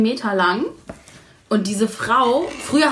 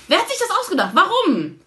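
exasperated rant; 10 semitones higher, faster than usual. Real, not acted. Ranting about Aldi checkouts.

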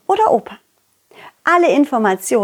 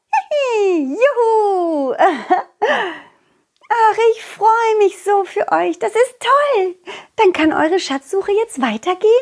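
put-on much higher, giggling little girl voice; happy butterfly girl; 9 semitones higher than usual. Butterfly girl happy for the children, giggling and much higher. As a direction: cheer for your friends, delighted.

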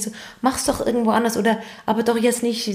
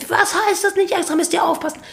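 scolding father; 8 semitones higher than usual. Retold or imitated.

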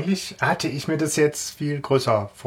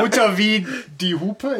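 laughing; 6 semitones higher than usual. Real, not acted. Joking mispronunciation of Huter.

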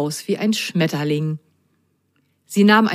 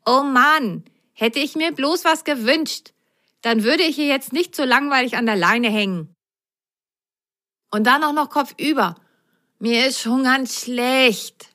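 bored sock whining on the washing line, pitched up; 6 semitones higher than usual. Acted. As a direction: moan about hanging upside down. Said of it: "jammerte die eine Socke".

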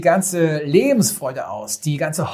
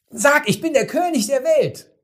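higher insistent call; 11 semitones higher than usual. Retold or imitated. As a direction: repeat the call, much higher. Director repeats call, louder.

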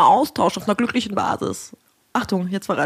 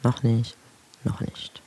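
fading to a whisper; 5 semitones lower, much slower than usual. Real, not acted. Repeats, fading out.

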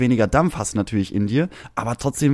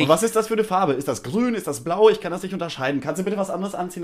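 put-on much higher, fast fussy old-lady voice; mock nagging neighbor; 7 semitones higher than usual. Outraged neighbor nagging about the sunshade, much higher voice. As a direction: nag like an outraged pensioner.

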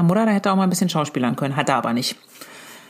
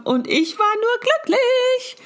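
blissfully happy; much slower than usual. Acted. Beaming about the happy ending.

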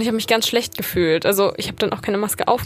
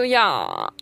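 vocal fry; 6 semitones lower than usual. Shown as a demonstration. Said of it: "Und das ist auch ein bisschen Vocal Fry".

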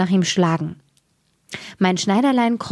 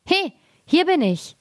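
a tiny thief calling out much higher, cheeky; teasing tiny call; 10 semitones higher than usual. A narrator's reading. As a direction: tease the guards from a hiding spot. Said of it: "und rief". (den Wachen aus dem Versteck zurufen, hoch und frech)

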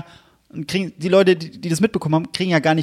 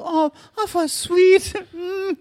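very high, sugary mock swoon; mock gushing; much slower than usual. Co-host plays the woman finding the note, mock gushing. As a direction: squeal it sweetly, making fun of the note.

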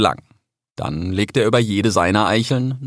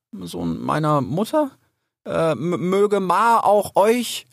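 higher, slow, stammered formality; flustered; 10 semitones higher, slower than usual. A narrator's reading. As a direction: grope through an unfamiliar greeting. (verlegen stotternd)

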